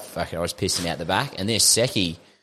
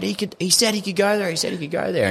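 mock yell; 12 semitones higher than usual. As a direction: insist he was allowed, pitched up. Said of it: "I'm, like, yelling at the food vendor".

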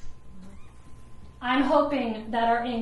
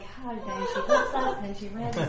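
mock congregation; 3 semitones lower, 5 dB quieter than usual. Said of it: "y'all are like".

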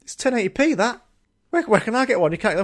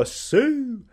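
mock battle cry; 10 semitones higher than usual. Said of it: "at the same time go".

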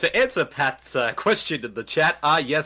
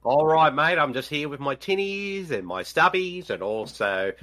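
normal talking voice

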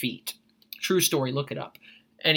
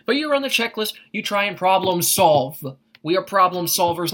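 usual speaking voice

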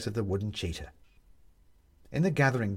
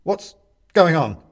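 frightened; 6 semitones higher, 10 dB louder, slower than usual. A narrator's reading. Frightened Benedict asks.

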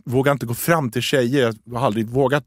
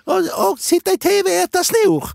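much higher sing-song parody; mocking high voice. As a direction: chirp it like a cartoon. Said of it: "Och så bara". (hög, fånig parodiröst)

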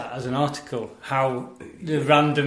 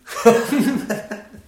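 laughing; 8 semitones higher than usual. Real, not acted. Laughs after singing.

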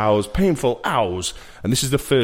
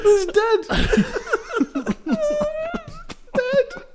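squeaky words through laughter; high laughing voice; slower than usual. Real, not acted.

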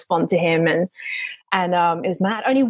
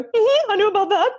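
much higher pretend-casual "Mm-hmm"; fake knowing; 13 semitones higher than usual. Wife bluffing to her husband, high strained fake calm. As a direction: bluff that you already knew. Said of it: "me having to go".

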